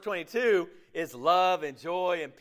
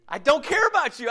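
higher and louder, care punched hard; emphatic repeat; 7 semitones higher, 7 dB louder than usual. Retold or imitated. Preacher repeating the selfish partner's words to the congregation, higher and louder. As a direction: hammer home "care" in "I don't care about you".

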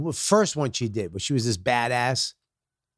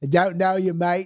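mock doorman in a raised Australian twang; Australian accent; 3 semitones higher, slower than usual. Comedian retries London doorman's line, comes out mock Australian. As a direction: send someone off in imitation Aussie, pitched up. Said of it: "you just did Australia".

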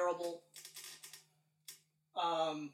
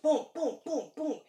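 higher, punched, evenly spaced booms; imitated booms; 7 semitones higher, 4 dB louder than usual. Podcaster mimicking plot events piling up, punchy higher booms. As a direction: punch out each boom like hits landing.

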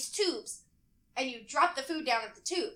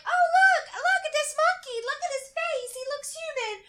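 gushing excitement; 13 semitones higher, 6 dB louder than usual. Retold or imitated. Woman showing her boyfriend a monkey, high excited gushing. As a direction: re-enact squealing delight at an animal.

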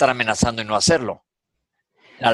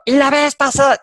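much higher, louder mock demand; imitating indignant father; 7 dB louder than usual. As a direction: fire the question back, outraged. Father's indignant question, quoted.

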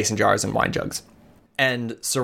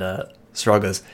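usual speaking voice

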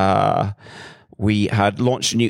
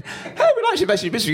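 much higher, fast, breezy and laughing; mock cheery investor; faster than usual. Coca-Cola on the phone to the struggling founders, hammed-up cheery caller. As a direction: play the big company calling all casual and sunny. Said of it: "I've slightly exaggerated".

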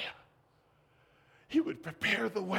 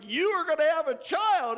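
mock announcement; 7 semitones higher, 5 dB louder than usual. Imagined news to old couple.

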